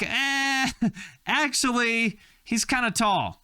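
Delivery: high-pitched mock God. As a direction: break bad news in a high, teasing voice. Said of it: "And then God was like".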